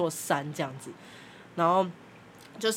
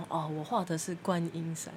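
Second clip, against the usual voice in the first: usual speaking voice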